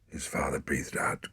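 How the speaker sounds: plain reading voice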